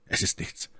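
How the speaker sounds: very soft, almost whispered; quiet reassurance. A narrator's reading. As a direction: reassure her under your breath. Sagte Felton. (leise, beruhigend gehaucht)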